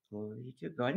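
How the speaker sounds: timid praise. Retold or imitated. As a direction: barely murmur "Glory to God". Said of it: "sh-, louder".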